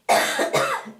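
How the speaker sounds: one harsh chesty cough. Real, not acted. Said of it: "I'm sorry, I'm coughing".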